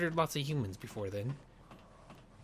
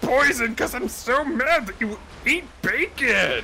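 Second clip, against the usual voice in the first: much higher, louder, slow angry rant; hammed-up pig fury; mock furious squeal; 10 semitones higher, 10 dB louder than usual. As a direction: spit out the rant like an enraged cartoon pig. Pig ranting angrily.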